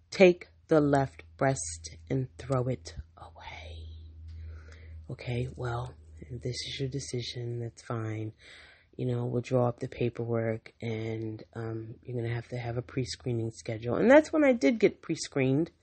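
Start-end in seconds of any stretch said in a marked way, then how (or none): 0.1-3.9 slow whispered flat instruction; whispered retold demand. Patient telling surgeon to remove breast, slow whisper. 5.1-14.0 much lower, much softer resigned doctor; mock surgeon. Surgeon conceding to patient, much lower and softer.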